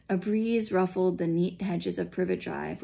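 usual speaking voice

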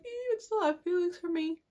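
quick, high, whiny question; mock pleading. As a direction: imitate a hurt, hurried plea up high. Acts out pleading with ex.

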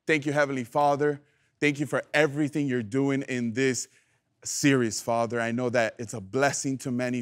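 soft, low spoken prayer. Acted. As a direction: pray humbly to the Father. Leads the congregation in prayer.

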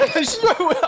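sudden high yelp of shock; startled shout. Real, not acted. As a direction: shout out in surprise. Sudden enemy fight in game.

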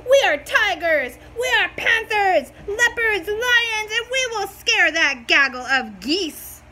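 loud, proud kitten boast; fierce kittens. A narrator's reading. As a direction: puff up and brag loudly. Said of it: "ever so fierce".

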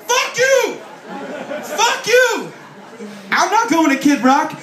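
mock furious yell. Punk fan turning down [name] tickets, mock furious yell. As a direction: bellow it like an offended punk, half laughing. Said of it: "You're like".

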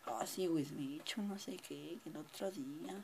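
soft whispered afterthought; whispered aside. Real, not acted. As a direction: whisper it under your breath.

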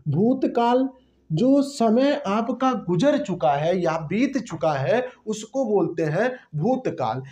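normal talking voice

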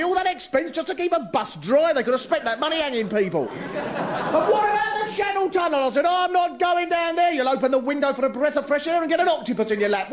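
outraged complainer, pitched well up; indignant ranting grumbler. Acted. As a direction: rant like an outraged letter-writer.

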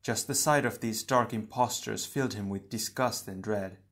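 usual speaking voice

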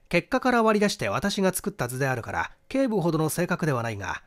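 ordinary delivery

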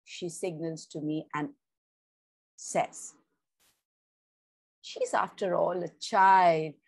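measured storyteller's reading voice; story narration. A narrator's reading. Narrator reading a story to channel listeners, storytelling tone. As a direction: read the tale aloud to your listeners, evenly.

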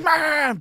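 high, loud battle yell; war cry. Retold or imitated. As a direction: scream like a soldier under attack. Vet throws live grenade.

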